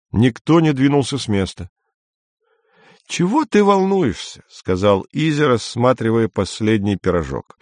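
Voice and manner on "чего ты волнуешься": high-pitched character voice; Izya, breezy. A narrator's reading. Izya reassures Andrei over the last pie, breezy.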